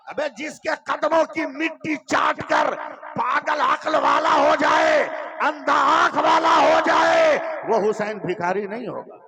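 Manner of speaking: high, loud, rising oratory; full-force preaching. Acted. Preacher to the Kishanganj gathering, full-force preaching. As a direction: thunder it to the crowd.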